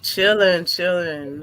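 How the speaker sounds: ordinary talk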